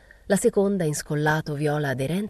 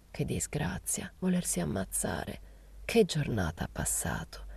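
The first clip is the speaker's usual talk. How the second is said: whispered, slow lament; hushed gossip; 8 dB quieter, slower than usual. A narrator's reading. Old woman laments the girl's suicide attempt, hushed whisper. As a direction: sigh it out under the breath, confidingly.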